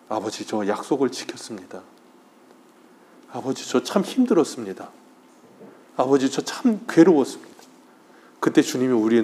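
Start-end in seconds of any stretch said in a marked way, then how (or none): 0.1-7.6 tearful confession. Retold or imitated. Believer meeting the Lord at life's end, quiet and tearful. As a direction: tell the Father softly how hard it was.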